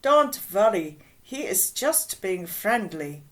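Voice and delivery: put-on reassuring woman with a German accent. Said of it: "in her German accent".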